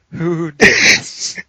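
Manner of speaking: slow, high, loud mock outrage; imitated accusing yell. Gym lifter demanding who farted, yelling slowly in mock outrage. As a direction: stretch it into a booming accusation. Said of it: "he's yelling at everyone around him".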